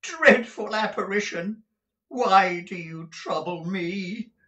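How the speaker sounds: high, loud and pleading with the phantom; frightened Scrooge. Acted. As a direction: cower and beg the spirit to leave. Scrooge terrified before the ghost.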